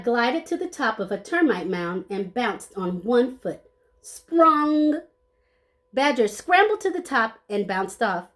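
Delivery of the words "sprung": high springy pop; bouncy sound effect. Acted. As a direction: bounce the word up. Sound word in story.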